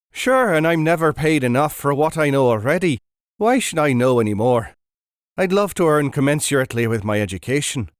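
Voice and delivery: loud broad Irish brogue; broad Irish accent. Acted. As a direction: boom it out in thick Irish. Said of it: "in a broad Irish accent".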